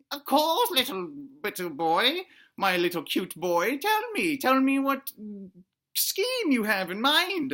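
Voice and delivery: swooping falsetto mama voice; mock motherly, drawn-out at the end; doting dinosaur mother. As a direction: coo it with pitch sliding up and down. Voicing the mother dinosaur's reply.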